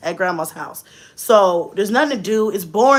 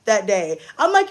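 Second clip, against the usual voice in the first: ordinary talk, nothing marked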